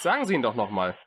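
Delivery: ordinary talk, nothing marked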